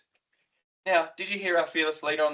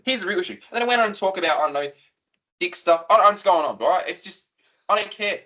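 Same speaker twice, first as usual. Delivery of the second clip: normal talking voice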